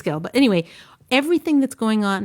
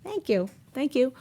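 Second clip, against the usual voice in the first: slow, high first thank you, then softer; gracious thanks; 4 semitones higher, 3 dB quieter than usual. Retold or imitated. Wife graciously thanking her husband, lightly acted. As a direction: accept the compliment sweetly.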